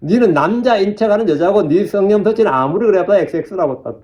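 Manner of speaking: normal talking voice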